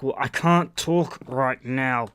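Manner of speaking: slow, deliberate "can't talk right now"; pointed hint. Retold or imitated. Caller dropping a hint to his girlfriend on the phone, pointed. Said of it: "I was like, you know, Hint, hint".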